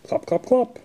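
high clip-clop in a put-on voice; clopping hooves. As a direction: click out the horse hooves up high. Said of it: "He can sound like horse feet".

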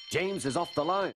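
high, urgent announcement; raising the alarm. A narrator's reading. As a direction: call out the bad news urgently. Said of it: "Soon came the alarm".

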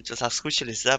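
normal talking voice

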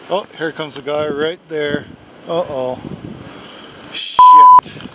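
normal talking voice

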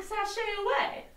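high, sing-song sashay away; sassy flourish. Pretend. Vlogger mimicking Lestat's exit, sassy and high. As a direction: flounce it off with sass.

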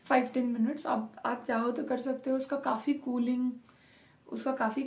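stiff lips under mask; restricted mouth movement; through a sheet mask. Real, not acted. Seen 0: Wet sheet mask covers face around mouth throughout; references show bare face.

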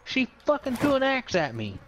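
high indignant complaint; outraged. Real, not acted. Outraged at teammate's axe.

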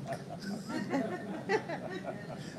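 soft, high giggle; soft high laugh. Real, not acted. Preacher laughing softly to congregation, high and light. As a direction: let a light laugh slip out.

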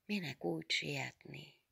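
put-on soft old nun's mumble; quietly muttered question; muttering. A narrator's reading. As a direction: mumble it to yourself, softly.

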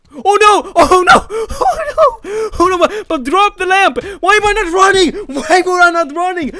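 high, loud panicked cries; panicked yelling. Real, not acted. Gamer fleeing the owner, loud panic. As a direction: shriek while trying to run.

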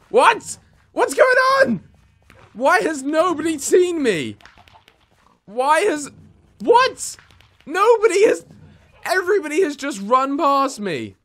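disbelieving surprise. Real, not acted. Players run past ignoring him.

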